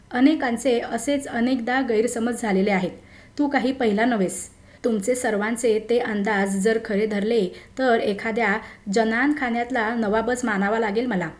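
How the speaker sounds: usual speaking voice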